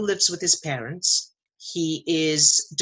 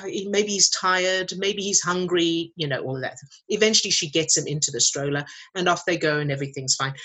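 ordinary delivery

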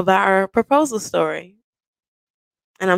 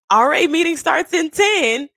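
put-on high girly voice; 9 semitones higher than usual. Friend's text read in voice.

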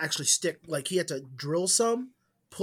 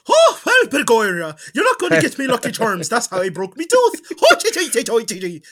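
loud, high cartoon leprechaun Irish; Irish accent flying off the rails; mock Irish accent; 6 semitones higher, 10 dB louder than usual. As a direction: ham up an over-the-top Irish brogue. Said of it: "you just go".

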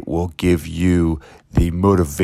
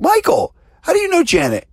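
very high, loud delighted question; surprised gush; 4 dB louder than usual. Acted. Gym client surprised to find her trainer talking to Janet, squealing. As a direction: squeal his name in happy surprise. Woman surprised to see Michael.

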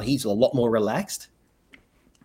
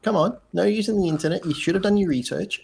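playful scolding; 7 semitones higher than usual. Real, not acted. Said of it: "teasing cohost".

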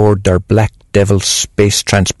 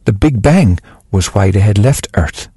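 usual speaking voice

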